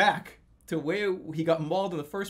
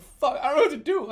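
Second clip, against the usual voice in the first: retold panic; 12 semitones higher, 5 dB louder than usual. Panic over mauled neighbor.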